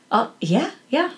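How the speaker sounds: lightly imitated Scottish accent; a hint of a Scottish friend's brogue; Mark's Scottish accent. Guest answers the sick kid's father, light Scottish accent. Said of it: "with his Scottish accent".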